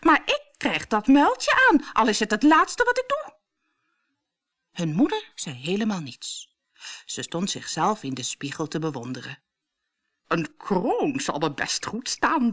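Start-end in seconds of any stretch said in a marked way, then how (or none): 0.0-3.4 high, loud, determined vow; defiant insistence. A narrator's reading. Stepsister vowing to fit the slipper, defiant. Sisters quarrel over the slipper. 10.3-12.5 slow, vain musing; self-satisfied musing. A narrator's reading. Stepmother admiring herself in the mirror, self-satisfied.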